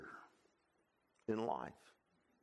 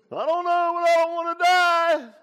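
put-on crying child; 16 dB louder than usual. Boy crying to his mom about death, high wailing sob. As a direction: wail it like a scared five-year-old. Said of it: "I was crying my eyes out".

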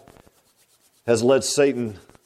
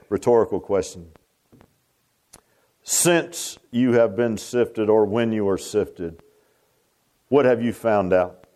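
ordinary delivery

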